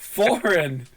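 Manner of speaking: exaggeratedly careful "foreign"; over-enunciating. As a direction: punch out "foreign" with mock precision. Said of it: "over enunciate and try to hide their accents".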